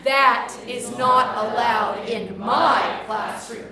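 very loud, slow, "my" swelling like a queen's decree; stress on MY. Shown as a demonstration. Teacher as queen of the classroom, stress on MY. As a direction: proclaim it grandly, leaning on "my". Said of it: "the last one is my favorite".

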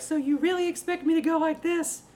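very high, loud, hammed-up objection; mock head voice. Skeptical teachers objecting, absurdly high loud voice. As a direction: exaggerate it way up into head register. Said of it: "people are like".

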